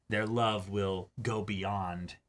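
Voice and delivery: usual speaking voice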